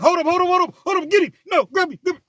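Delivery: high, loud frantic yelling; chaotic shouting. Retold or imitated. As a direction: shout it as a scuffle breaks out. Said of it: "it was a like".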